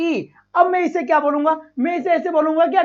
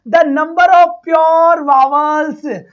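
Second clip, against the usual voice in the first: the number of pure vowels read very slowly and louder; slow, louder question read; 4 dB louder, much slower than usual. Shown as a demonstration. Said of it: "reading the question slowly".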